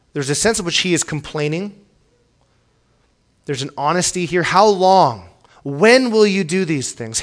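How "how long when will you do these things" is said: pleading cry. Acted. Voicing psalmist's complaint.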